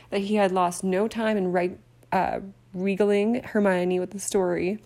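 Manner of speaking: usual speaking voice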